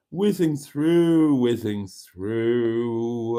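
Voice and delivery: very slow, stretched-out words; drawn-out skimming filler. Real, not acted. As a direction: stretch each word while busy scanning comments. Narrates skimming through the comments.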